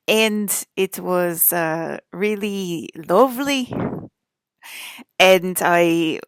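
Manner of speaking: loud, slow put-on Irish accent. Shown as a demonstration. Vlogger attempting a broadcast as an Irish person, loud Irish accent. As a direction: project a hammed-up Irish accent, drawing words out. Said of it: "trying to do the Irish accent for you guys".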